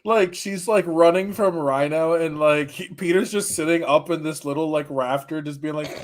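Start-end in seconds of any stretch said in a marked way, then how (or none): none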